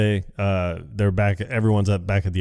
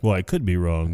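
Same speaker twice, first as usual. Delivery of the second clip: quoting Ron's knowing tone; 2 semitones lower than usual. Retold or imitated. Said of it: "the way he says it".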